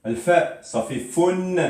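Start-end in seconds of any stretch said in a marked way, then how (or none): 1.0-1.5 fun said high as "founn"; tanwin damma. Shown as a demonstration. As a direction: sound fun as "founn", ringing final n. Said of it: "Rappelez-vous, N E". (prononcer fun « founn », aigu, n sonore)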